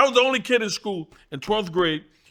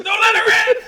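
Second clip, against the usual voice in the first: high loud pleading cry; childish panic; 5 dB louder than usual. Retold or imitated. Scared kid in class begging the teacher, high panic. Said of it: "I said".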